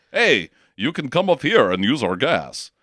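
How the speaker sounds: badly faked Russian accent. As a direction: do a clumsy mock Russian accent. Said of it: "the worst Russian accent I have ever done".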